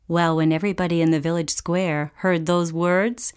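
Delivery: ordinary delivery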